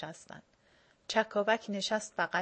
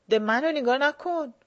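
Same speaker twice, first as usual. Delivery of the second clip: loud firm command, higher; 5 semitones higher, 8 dB louder than usual. A narrator's reading. Dashakol ordering Baran firmly, loud. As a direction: order him sharply. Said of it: "داشاکل محکم گفت".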